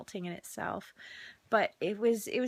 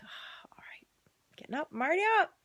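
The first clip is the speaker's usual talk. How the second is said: resigned grumble; 7 semitones higher, faster than usual. Retold or imitated. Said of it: "I'm like".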